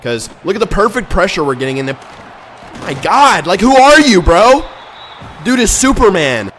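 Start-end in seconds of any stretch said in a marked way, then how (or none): 2.8-6.5 loud, high yell of disbelief; loud disbelieving gamer shout; incredulous shout. Real, not acted. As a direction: yell at the screen. Opponent breaks free again.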